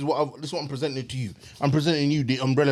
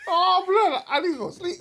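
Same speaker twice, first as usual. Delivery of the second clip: laughing. Real, not acted. Still laughing, exhausted.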